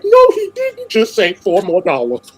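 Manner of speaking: loud, high, drawn-out incredulity; slow it right down, scandalised retelling; exaggerated how-dare-he outrage; mock disbelief. Acts out own disbelief at request.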